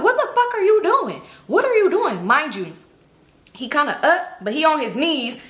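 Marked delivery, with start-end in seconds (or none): 0.0-2.3 high-pitched retold outburst; loud confrontation. Confronting a man caught with another girl, retold outburst. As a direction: demand an answer in a raised, high voice. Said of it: "It's loud and stuff like that".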